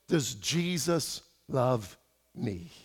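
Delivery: put-on soft Croatian accent. Pastor imitates the Croatian driver asking about Jesus, soft and slow. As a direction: ask it gently in a Croatian accent, barely aloud. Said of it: "I don't know how to do a Croatian accent".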